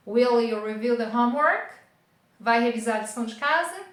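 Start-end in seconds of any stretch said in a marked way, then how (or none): 0.1-1.7 "homework" lifted in a clear questioning rise; rising intonation. Shown as a demonstration. Teacher showing the tune going up, rising intonation. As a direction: make "Will you review the homework?" climb upward at its close. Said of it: "como se ela estivesse subindo".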